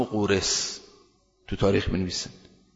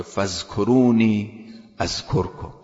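slow Quran recitation; 3 dB louder, much slower than usual. Acted. Quoting the Quran verse.

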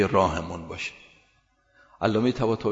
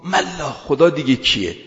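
louder, higher angry scoff, slow; angry retort; 5 semitones higher, 7 dB louder than usual. Retold or imitated. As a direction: sneer it in anger. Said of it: "او بیشتر عصبانی شد". (پرخاش خشمگین و بلند)

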